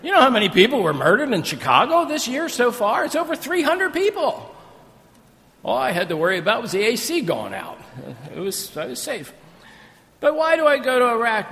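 loud, high preaching; full-force preaching. Acted. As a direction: drive it home to the congregation.

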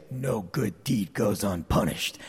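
low, slow and soft, a lightly mocked grumble; grumbling old saying. Pastor imitating his old self, a mild low grumble. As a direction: mutter it like a tired cynic, slowly. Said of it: "And I used to say".

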